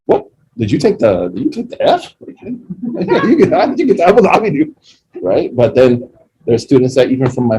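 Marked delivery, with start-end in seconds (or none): 0.0-4.8 high, fast disbelieving questions; hammed-up shock at the news; imitating his own stunned reply; mock astonishment. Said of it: "I was like".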